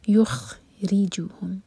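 یخرجوہم with a full heavy kha, lips spread; weight the kha of یخرجوہم, very slowly. Shown as a demonstration. Teacher demonstrates یخرجوہم for the class. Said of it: "خا heavy letter ہے".

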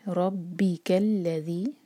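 रब्बिकल्लज़ी very slow, heavy रॉ, strong ब; recitation demo. Shown as a demonstration. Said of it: "रॉ पर फतहा". (रब्बिकल्लज़ी धीमी तिलावत)

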